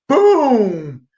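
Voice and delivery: high, drawn-out "boom"; imitated knockout boom. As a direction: boom it out like a punch landing. Imitates landing the knockout blow.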